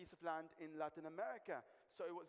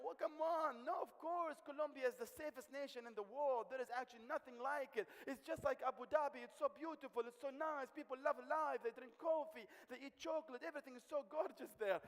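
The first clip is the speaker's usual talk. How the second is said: higher, gushing woman's voice praising her country; gushing Juanita; 7 semitones higher, 3 dB louder than usual. Retold or imitated. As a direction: play the proud lady selling her homeland. Juanita boasts about Colombia.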